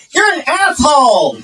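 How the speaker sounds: high-pitched campy indignation. Acted. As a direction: throw it out high and camp.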